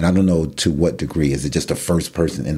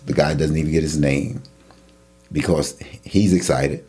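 ordinary talk, nothing marked

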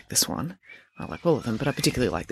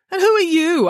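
sharp demand; 5 dB louder than usual. Acted. Headmaster confronts the stranger.

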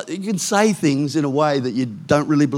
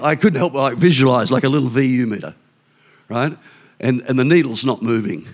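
usual speaking voice

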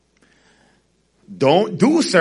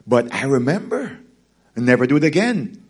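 ordinary talk

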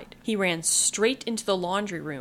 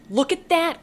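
high loud alarmed cry; cried out; 10 semitones higher, 6 dB louder than usual. A narrator's reading. Little girl crying out at the cat, high and loud. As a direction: cry out, pointing. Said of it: "cried Karen".

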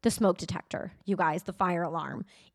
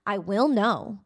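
ordinary talk, nothing marked

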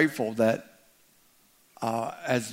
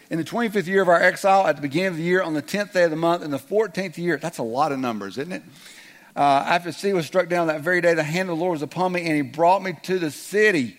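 fast, higher rattled reading; rushed retry; 4 semitones higher, 3 dB louder than usual. Pretend. Pastor retrying the Ezekiel reading, fast and rushed. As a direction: race through the numbers. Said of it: "Let's try that again".

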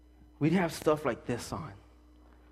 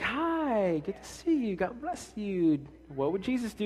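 higher, softer, sugary churchy voice; fake sweet greeting; 6 semitones higher, 4 dB quieter than usual. Pastor mocking churchgoers greeting at the door, fake sweet voice. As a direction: put the mask on, all sweetness.

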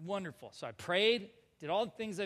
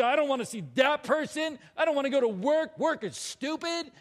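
tantrum; 6 semitones higher, 5 dB louder than usual. Retold or imitated. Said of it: "I literally threw a tantrum".

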